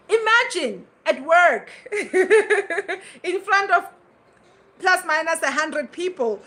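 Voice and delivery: high laugh through the words. Real, not acted. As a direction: laugh it out, a bit embarrassed. Laughing at own nervous story.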